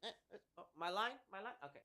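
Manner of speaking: quiet, caught-off-guard mumble; idle bored actor. Retold or imitated. Actor sitting handcuffed all day missing his cue, mock-startled and quiet. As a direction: imitate an actor who missed his cue, softly. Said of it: "him just being like this".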